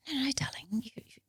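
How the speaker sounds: high hushed grandmother voice; grandma gently urging her to share, high and hushed; grandma's whisper. Retold or imitated. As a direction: whisper it like a kindly old gran. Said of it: "she goes".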